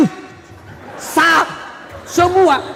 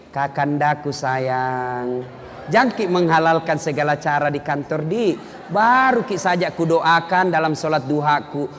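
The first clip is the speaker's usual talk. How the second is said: loving wife; 8 semitones lower, 4 dB quieter than usual. Retold or imitated. Wife's loving phone message.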